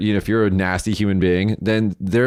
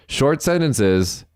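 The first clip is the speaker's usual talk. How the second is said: slow, pointed listing; 3 semitones higher, slower than usual. Real, not acted. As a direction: draw out each item as if it's painfully obvious. Spelling out an obvious list.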